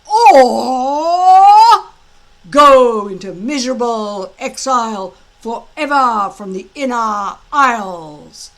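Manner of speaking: loud, high, gloating drill-master voice; a big blustering Viking instructor gloating at his recruits; booming Gobber. Acted. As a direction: lord it over the boys with relish. Gobber's speech to the boys.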